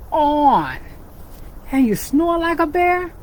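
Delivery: lively storybook reading for little ones; animated picture-book narration; reading to children. A narrator's reading. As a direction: read it like storytime with kids.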